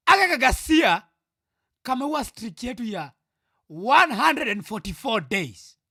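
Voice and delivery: loud, high angry outburst; furious, emphatic complaint; really pissed off. Real, not acted. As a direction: shout it out, slowing on the key words. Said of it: "So, I am pissed".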